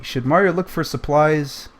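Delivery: ordinary delivery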